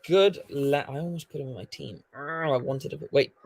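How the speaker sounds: normal talking voice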